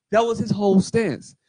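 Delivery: ordinary delivery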